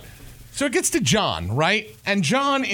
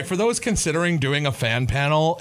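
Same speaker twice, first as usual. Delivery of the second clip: usual speaking voice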